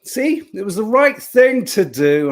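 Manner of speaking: booming cheerfully; caffeine-fuelled loud chat. Real, not acted. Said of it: "I don't know why I'm shouting".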